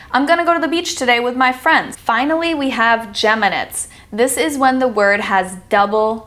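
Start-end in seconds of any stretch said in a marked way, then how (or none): 0.1-2.0 quick, relaxed everyday sentence, gonna run together; casual fast speech. Shown as a demonstration. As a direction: toss it off fast like casual chat. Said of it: "a lot of the times we say".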